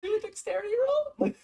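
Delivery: imitating the DM. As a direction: ask the roll in a high, amused voice. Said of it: "And he goes like".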